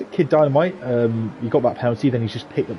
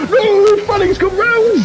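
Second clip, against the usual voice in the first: excited shout; 7 dB louder, slower than usual. Real, not acted. Car spins after jinx.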